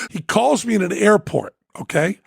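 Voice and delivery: normal talking voice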